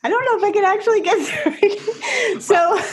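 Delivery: high, tearful laugh through the words; high-pitched and choked up, breaking into laughter; trying not to cry. Real, not acted. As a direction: laugh to hold back real tears. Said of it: "trying not to cry".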